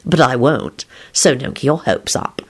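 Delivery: low, understated smug reply; smirking. A narrator's reading. Lupin dashing a cellmate's hopes, smirking. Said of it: "Lupin responded with a smirk".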